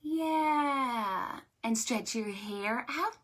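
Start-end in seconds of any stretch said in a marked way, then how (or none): none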